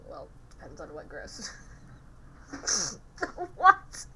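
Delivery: ordinary talk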